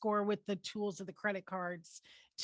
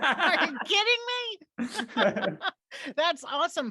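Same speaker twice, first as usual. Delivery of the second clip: laughing disbelief; 6 semitones higher, 7 dB louder, slower than usual. Real, not acted. Host reacts to rewards story.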